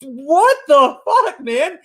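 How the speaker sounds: laughing disbelief. Retold or imitated. Recalling his reaction to the streamer, laughing in disbelief. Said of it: "I'm going like".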